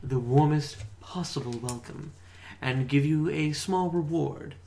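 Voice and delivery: a hint of a polite, bowing lord; courtly stranger. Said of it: "he bows deeply and says".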